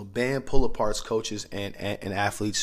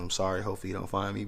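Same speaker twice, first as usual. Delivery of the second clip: usual speaking voice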